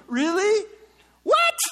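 very high, loud incredulous squeak; a sarcastic, hammed-up surprise; mock astonishment. Real, not acted. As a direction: act shocked, jumping way up in pitch. Mock disbelief at claim.

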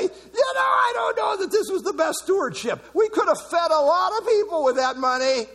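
high, loud mock protest; a hammed-up pious complaint, pitched high and loud; mock objecting disciple. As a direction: disciple protesting the wasted perfume, high and shouting. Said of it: "You might too say".

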